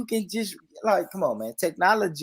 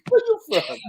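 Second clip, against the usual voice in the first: very high British question; high mock British accent; 4 dB louder than usual. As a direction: squeak it out in a silly British accent. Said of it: "I can just hear it".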